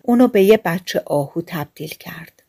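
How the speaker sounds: usual speaking voice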